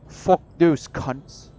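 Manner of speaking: hammed-up British accent, each word punched out; meme British accent. Player redoing the insult for laughs, exaggerated British accent. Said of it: "do the actual accent or a meme accent".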